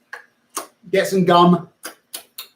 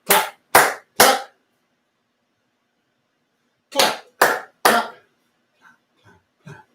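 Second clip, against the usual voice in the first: whispered clap chant; 7 semitones higher, slower than usual. Shown as a demonstration. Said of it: "I'm gonna do some clapping".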